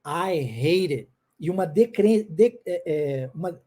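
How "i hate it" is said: "I hate it" slow and low, dropping at the end; falling ending. Shown as a demonstration. Teacher showing a drop at the end, slow falling "I hate it". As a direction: stretch "I hate it" and let the end sink. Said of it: "uma descida no final".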